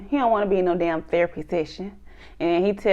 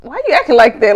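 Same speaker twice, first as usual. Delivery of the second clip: louder retold quote; 3 semitones higher, 8 dB louder, faster than usual. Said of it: "He was like".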